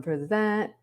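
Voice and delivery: normal talking voice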